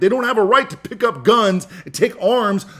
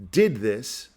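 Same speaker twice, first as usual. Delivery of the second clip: usual speaking voice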